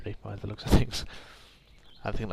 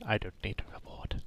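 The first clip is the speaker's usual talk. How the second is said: whispered aside, much softer; 3 semitones lower, 7 dB quieter than usual. Real, not acted. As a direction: breathe it out with no voice. Reading dialogue option softly.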